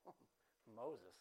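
"Oh, Moses" dropped low and soft on a falling tune. Shown as a demonstration. Preacher showing his own take to the church: low, soft, falling. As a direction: let "Moses" sink low and quiet, falling away. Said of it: "And I say it like".

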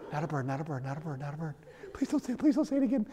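very soft, hushed repeated plea; terrified muttering. Retold or imitated. Dad lying in bed beside daughter, mock terrified mutter. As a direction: mutter it under your breath in mock dread.

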